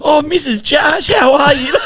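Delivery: very high, drawn-out mock squeal; gushing greeting. Uber stranger greeting friend's mum at three A M, gushing. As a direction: squeal a hammed-up hello, loud at the end.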